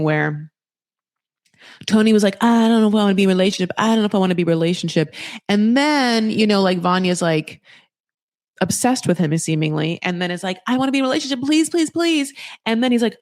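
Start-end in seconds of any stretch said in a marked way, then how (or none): 2.4-5.1 rattled-off double-speed waffling; mimicked dithering. Mimicking Tony's wavering, rattled off twice. 10.7-12.3 high-pitched desperate pleading; mock begging. Mimicking a needy partner begging.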